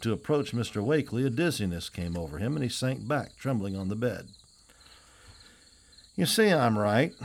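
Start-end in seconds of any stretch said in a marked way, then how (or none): 6.1-7.1 slow, smug villain, a touch louder; a plotter's mild smug menace; evil smile. A narrator's reading. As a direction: gloat with a smile you can hear. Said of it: "with an evil smile".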